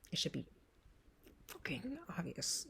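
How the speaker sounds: breathy whispered swear under her breath; whispered curse. Real, not acted. Vlogger whispering a curse to her viewers, whispered. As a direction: whisper the curse like a secret aside.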